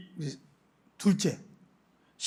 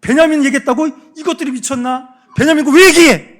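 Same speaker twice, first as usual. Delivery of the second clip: high, loud furious yelling; furious father; 8 semitones higher, 9 dB louder than usual. Retold or imitated. Father Jacob yells at his sons, higher and loud. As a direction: scold the sons as an outraged father. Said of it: "막 그러는 거예요".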